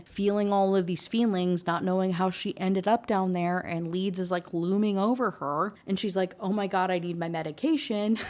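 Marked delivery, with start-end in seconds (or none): none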